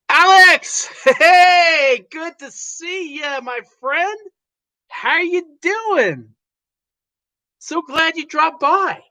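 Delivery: enthusiastic welcome. Acted. Host greets a guest enthusiastically.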